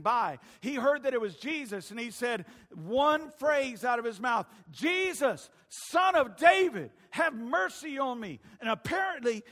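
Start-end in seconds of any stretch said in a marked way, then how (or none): 4.7-8.4 calling out loud and high over a crowd; loud-mouthed cry. Acted. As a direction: cry out for mercy at full voice. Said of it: "he had a loud mouth".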